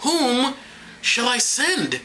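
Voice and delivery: high, measured question with a pause; plainer scripture reading. Acted. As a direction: read it lightly, pausing after the first word. First take, before apologising to God.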